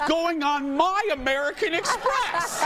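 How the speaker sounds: slow, climbing comic outrage; mock-indignant reveal. Real, not acted. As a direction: stretch out the punchline, pitch climbing high. Storyteller's indignant punchline reveal.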